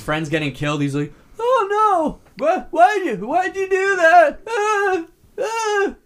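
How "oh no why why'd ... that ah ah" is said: much higher, hammed-up wail of a terrible actor; mocking bad acting. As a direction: overact the grief like the worst actor in the film. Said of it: "that's literally his performance".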